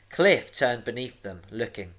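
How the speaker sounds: ordinary delivery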